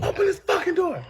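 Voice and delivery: high angry shout through the door; mother yelling. Retold or imitated. Retold mom yelling through the door.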